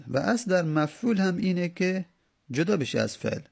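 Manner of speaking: usual speaking voice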